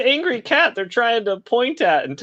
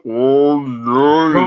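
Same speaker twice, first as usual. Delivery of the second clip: slow motion voice; 3 semitones lower, 4 dB louder than usual. Retold or imitated. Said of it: "the sound bites in slow motion".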